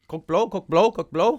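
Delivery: pushy dealer impression. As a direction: pitch it like a street dealer.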